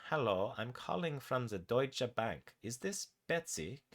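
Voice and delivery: put-on slow, lilting German-accented woman. German woman from the bank calling Betsy, German accent. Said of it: "A woman with a German accent".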